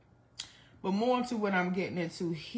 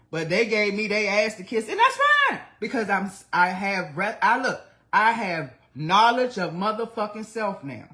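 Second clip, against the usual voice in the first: loud, high, defiant outburst; heated rant; 4 semitones higher, 8 dB louder than usual. Real, not acted. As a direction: push back loudly with real indignation. Heated rant about family's refusal.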